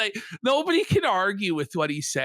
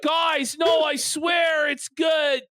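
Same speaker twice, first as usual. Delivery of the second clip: mock pleading Joe; 9 semitones higher, slower than usual. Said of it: "imitating Joe".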